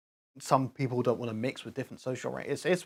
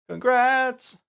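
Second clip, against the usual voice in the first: excited congrats; 11 semitones higher, 7 dB louder than usual. Real, not acted. Congratulating chat.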